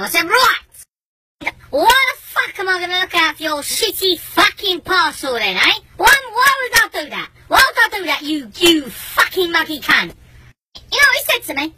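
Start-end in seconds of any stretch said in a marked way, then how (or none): 0.3-10.1 high, heated doorstep rant; angry tirade. Retold or imitated. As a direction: berate the neighbour, slowing on the final insult.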